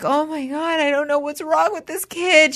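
high, lost, despairing; desperate parent. Retold or imitated. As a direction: pitch up in helpless despair. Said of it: "I'm like".

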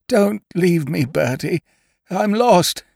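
high, plaintive lost-soul voice; helpless pleading. A narrator's reading. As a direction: beg a friend not to go. Lost friend pleads for help.

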